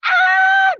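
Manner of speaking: very high, loud scream of terror; terrified scream. A narrator's reading. The three fleeing up the stairs, screaming in terror. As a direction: scream all out. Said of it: "They all screamed again".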